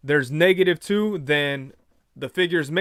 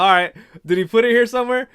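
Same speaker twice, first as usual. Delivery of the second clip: exasperated push; 5 semitones higher, 5 dB louder, faster than usual. Retold or imitated. Pressing clerk about missing figure.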